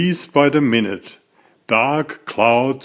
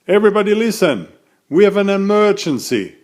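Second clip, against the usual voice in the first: calling out; 8 semitones higher, much faster than usual. Acted. Cat calls the animals to order.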